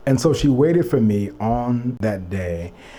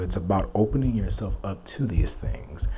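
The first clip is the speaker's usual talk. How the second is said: ordinary talk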